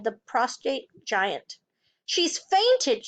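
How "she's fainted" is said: high, urgent exclamation; startled teacher raising the alarm; cried out. A narrator's reading. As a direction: cry out in alarm. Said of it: "she cried".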